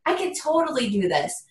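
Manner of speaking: high, bright gasp of discovery; the moment it clicks, voiced high; retelling her surprise at conference; sudden realization. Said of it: "And I was like".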